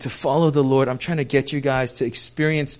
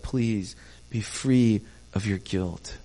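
usual speaking voice

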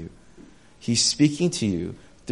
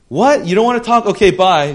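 loud, higher, curt dismissal; impatient brush-off; 9 semitones higher, 9 dB louder than usual. Retold or imitated. Busy person shouting across the room, annoyed and dismissive. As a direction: shrug them off and leave. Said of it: "they're like".

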